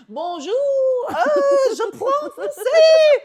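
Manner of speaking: shrieking high and loud with laughter on je parle français; mock-proud French shout; eager French greeting. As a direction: show off your French, overexcited. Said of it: "And she's like".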